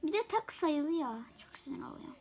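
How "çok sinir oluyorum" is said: frustrated whisper. Real, not acted. Frustrated whisper after a mistake.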